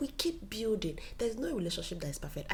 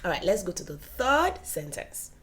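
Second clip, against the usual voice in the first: normal talking voice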